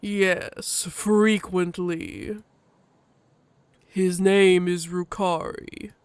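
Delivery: very slow, heavy husky voice; grumpy Loken. Acted. Loken introduces the newcomer, slow and grumpy. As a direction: grumble it out word by word. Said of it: "Loken snorts grumpily".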